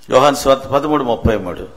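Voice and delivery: usual speaking voice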